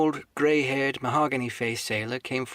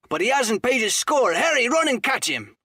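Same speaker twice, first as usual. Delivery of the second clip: loud, higher gruff outburst; crying out; 11 semitones higher, 6 dB louder than usual. Acted. Silver shouts about the unpaid bill, loud and gruff. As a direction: bark it out across the tavern. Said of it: "cried Silver".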